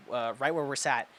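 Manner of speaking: ordinary talk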